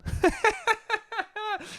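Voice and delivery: a quick high burst of laughter. Real, not acted. Podcaster laughing at his own blunt remark, high laugh. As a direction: laugh at your own joke.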